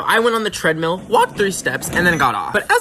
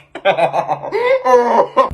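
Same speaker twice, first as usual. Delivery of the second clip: giggling; 5 semitones higher than usual. Real, not acted. Laughing at the word.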